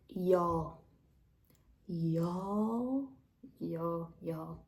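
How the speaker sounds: Southern "y'all" drawn out, trailing off each time. Retold or imitated. Explaining which Southern words stuck, Southern accent. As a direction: say y'all like a Tennessee local, fading away. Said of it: "That one caught".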